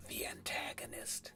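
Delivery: unvoiced dramatic whisper. Reviewer introducing the villain, hushed whisper. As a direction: whisper it like a secret reveal.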